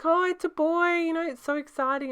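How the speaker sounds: high, loud gushing voice; excited mother-in-law. Retold or imitated. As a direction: gush it out, way up high. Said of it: "She was like".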